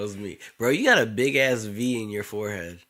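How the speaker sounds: normal talking voice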